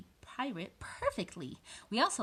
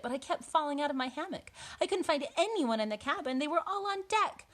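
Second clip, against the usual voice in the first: dramatic storybook narration, building higher; reading to children; 4 semitones higher, 3 dB louder than usual. A narrator's reading. Boy tells of storm at night, dramatic narration. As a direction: build the storm scene toward urgency. Reads picture book aloud.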